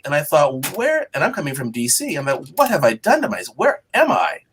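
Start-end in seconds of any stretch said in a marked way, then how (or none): 0.8-1.1 high, incredulous one-word question; recalling a jolt of dismay; startled disbelief. Retold or imitated. Newcomer recalling his shock at Florida, incredulous. 2.5-4.4 higher, half-mock panicked questions; reliving his new-job regret, lightly hammed; retold dismay. Young reporter questioning his move south, mock dismay.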